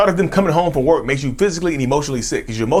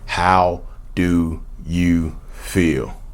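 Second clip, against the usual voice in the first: extremely slow and low; slow, word-by-word question; 6 semitones lower, much slower than usual. Real, not acted. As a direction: let each word sink in.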